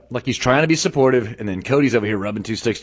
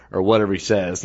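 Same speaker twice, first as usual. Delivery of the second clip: ordinary talk, nothing marked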